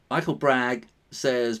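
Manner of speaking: ordinary talk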